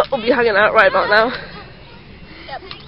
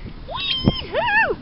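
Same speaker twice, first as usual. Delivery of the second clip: very high gleeful wee-hoo; thrilled whoop; 7 dB quieter than usual. Real, not acted. Rides the spinning toy.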